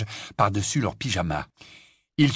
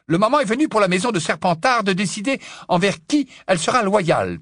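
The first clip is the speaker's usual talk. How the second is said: brisk, higher-pitched ruling; decisive; 10 semitones higher, 4 dB louder than usual. A narrator's reading. Professor settling the matter with Slughorn, decisive. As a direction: cut off all objection firmly. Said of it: "trancha le professeur McGonagall".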